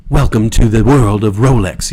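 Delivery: mock luxury ad announcer in a British accent. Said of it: "it's always a British person doing the voiceover".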